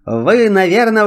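usual speaking voice

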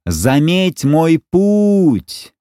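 high, very slow drawn-out cry; shouting. Acted. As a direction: stretch the cry out as he is thrown. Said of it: "Мальчик выкрикнул последние слова".

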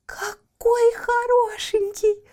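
high, slow and gasping with delight. Acted. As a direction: coo over the puppy. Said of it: "ахнула Барбариска".

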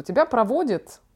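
usual speaking voice

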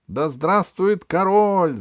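loud, high, drawn-out cheer; ringing court cheer; shouted acclamation. A narrator's reading. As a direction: hail the king like a crowd of courtiers. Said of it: "закричали придворные".

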